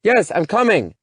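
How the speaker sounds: high, loud shout; yelling back. Acted. Daughter yelling back at her mother through the wall, loud. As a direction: yell it at the wall to be heard. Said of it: "Callie yells at the wall".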